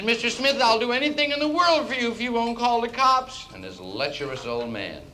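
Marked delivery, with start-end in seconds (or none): 0.0-3.5 very high put-on woman's voice; desperately pleading secretary. Secretary offers anything to avoid the cops, pleading. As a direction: beg desperately, pitched way up.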